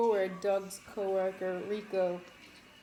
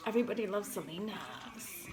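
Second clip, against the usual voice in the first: softer put-on voice, "Selena's" ending in S; brother's line imitation; 5 dB quieter than usual. Quoting the brother from Selena, put-on accented "Selena's". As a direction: mimic the film line, stretching "Selena's". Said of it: "It was the brother that said it".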